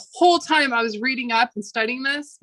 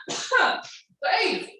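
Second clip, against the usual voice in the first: high wheezy croak; 10 semitones higher than usual. Real, not acted. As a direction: squeeze the word out, throat burning. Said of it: "spice in my throat".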